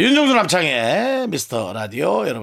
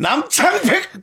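laughing call; 13 semitones higher, much slower than usual. Real, not acted. Calls out name laughing.

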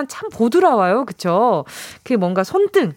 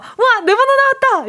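higher, louder, a joyful shout as a winner; excited cry; 12 semitones higher, 3 dB louder than usual. Pretend. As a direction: whoop that your number came up. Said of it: "이렇게 기뻐해 주시면".

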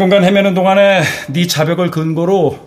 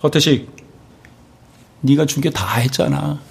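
usual speaking voice

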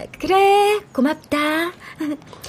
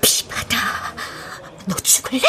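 whispering; 8 semitones lower, 7 dB quieter than usual. Acted. Mutters nickname angrily.

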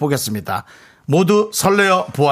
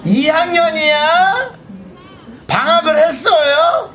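calling from afar; 10 semitones higher than usual. Real, not acted. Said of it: "멀리서 얘기해서".

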